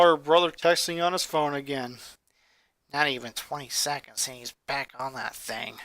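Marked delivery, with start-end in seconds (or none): 2.9-5.8 much softer, low annoyed grumble; irritable mutter. Acted. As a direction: grumble it under your breath. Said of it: "she muttered irritably".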